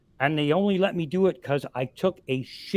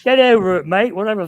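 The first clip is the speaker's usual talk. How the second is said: put-on British accent; 4 semitones higher, 9 dB louder, faster than usual. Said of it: "Fucking British accents are fun".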